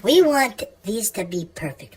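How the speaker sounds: ordinary delivery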